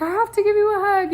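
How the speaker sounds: high, loud, fast gush; excited. Retold or imitated. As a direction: burst out with eager affection. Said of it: "she was like".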